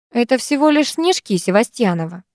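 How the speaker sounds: ordinary delivery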